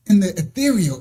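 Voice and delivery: normal talking voice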